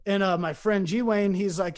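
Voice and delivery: ordinary talk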